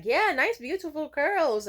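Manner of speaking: high, loud, overdone admiration; mock gaslighting praise. Stylist passing off failed curls, mock enthusiastic. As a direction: gush falsely, drawing out the praise. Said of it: "tried to gaslight me".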